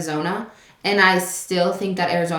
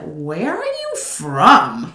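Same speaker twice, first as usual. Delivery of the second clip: puzzled missionary; 3 semitones lower, much slower than usual. Retold or imitated. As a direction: stretch each word, mock bafflement. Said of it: "he was like".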